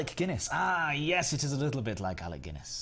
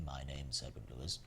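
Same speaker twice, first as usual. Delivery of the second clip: much lower, softer suave presenter voice; mock professional voice; 9 semitones lower, 10 dB quieter than usual. Streamer mocks a professional host, low and soft. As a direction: drop into a smooth posh host. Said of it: "Welcome to the professional live stream".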